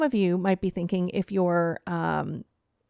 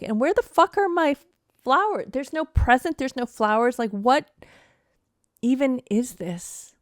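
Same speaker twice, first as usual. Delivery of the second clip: higher, louder retold angry yell; 3 semitones higher, 4 dB louder than usual. Wife yelling at husband over no Mother's Day flowers, angry retold yell. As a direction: replay the fury of the fight, pitched up. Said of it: "I kept yelling".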